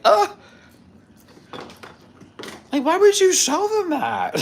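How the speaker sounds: very high, loud mock disgust; disgusted cat voice. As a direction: squeal it as the offended cat.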